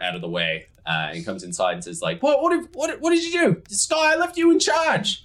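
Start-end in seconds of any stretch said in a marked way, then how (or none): 2.2-5.2 high, loud, stammering outrage slowing at the end; exasperated scolding. Acted. Yuri scolding Sky, who was left in charge. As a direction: sputter in disbelief at the mess.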